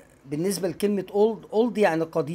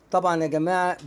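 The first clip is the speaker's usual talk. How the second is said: normal talking voice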